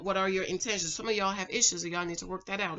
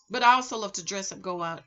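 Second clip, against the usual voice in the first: normal talking voice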